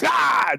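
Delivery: high mock-dramatic "ah!"; hammed-up choral hit; dramatic shouted sting. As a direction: cry out like a film score stab. Imitates dramatic sting after reveal.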